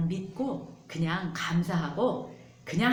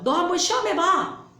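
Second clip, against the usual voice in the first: much higher and louder goading dare; taunting devil; 11 semitones higher, 8 dB louder than usual. Acted. Pastor voicing the devil daring Jesus to test God, loud. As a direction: goad him like a taunting devil. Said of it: "마귀가 그렇게 얘기하고 있어요".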